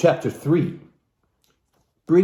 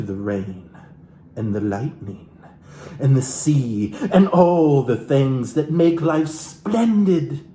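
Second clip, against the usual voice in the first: building, slowing list; slower than usual. Acted. Builds a list of grand things.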